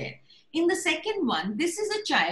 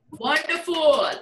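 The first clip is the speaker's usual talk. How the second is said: normal talking voice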